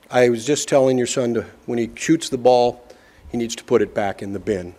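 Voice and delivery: quiet, courteous tone; very calmly. Retold or imitated. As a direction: gently explain to the mother. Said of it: "very calmly".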